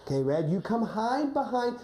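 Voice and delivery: normal talking voice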